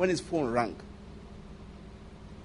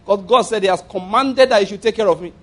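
ordinary delivery

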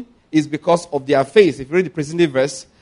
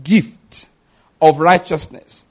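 "gift" set apart and drawn out, then a pause; stress on gift; 5 dB louder, much slower than usual. Shown as a demonstration. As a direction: hit "gift" emphatically, pause before the rest. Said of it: "we emphasize that word again".

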